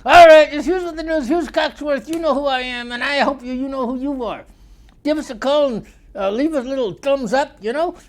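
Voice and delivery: loud, high radio host sign-off; show announcer. Acted. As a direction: sell the show like a cheesy host.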